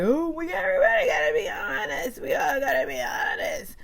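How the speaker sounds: high whiny mock plea for honesty; mock whiny mediator voice; Kyle impression. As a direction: do a strained imitation of the mediator. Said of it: "her voice is a hard one to try to capture".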